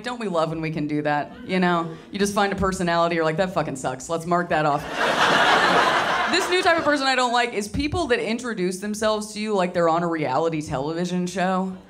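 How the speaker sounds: normal talking voice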